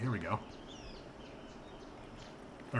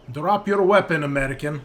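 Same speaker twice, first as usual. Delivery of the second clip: put-on loud command in a Russian accent; 2 semitones higher, 6 dB louder than usual. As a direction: order them firmly as a Russian soldier, raised voice. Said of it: "I should drop the Russian accent".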